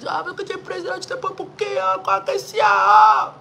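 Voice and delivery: high, fast, rising to a shout; defiant retort. Retold or imitated. Telling the congregation what she shouted at her father, high and fast. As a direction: hurl it at the father, building. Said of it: "j'ai comment dit ça à mon père".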